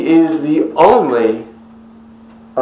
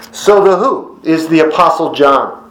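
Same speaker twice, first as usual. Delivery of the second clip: ordinary talk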